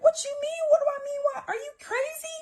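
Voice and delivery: put-on shrieking at the boyfriend, crying and outraged; 1 retold outraged cry. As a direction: shriek it at him in disbelief. Retold screaming fight.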